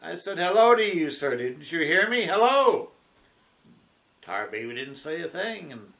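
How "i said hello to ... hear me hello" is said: higher, louder, insistent greeting; indignant rabbit. Acted. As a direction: demand an answer, getting cross. Rabbit greets silent Tar Baby.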